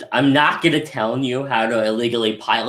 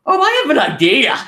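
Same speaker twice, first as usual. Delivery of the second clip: high sly villain line; lightly voiced scheming Frieza; Frieza's scheming voice; 13 semitones higher, 5 dB louder than usual. Frieza turning on Paragon, sly high scheming voice. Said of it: "He's like".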